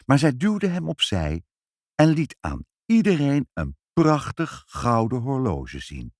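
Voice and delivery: ordinary delivery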